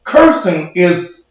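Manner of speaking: normal talking voice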